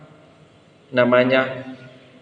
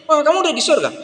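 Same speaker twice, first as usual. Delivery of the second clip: put-on surprised child; 12 semitones higher, faster than usual.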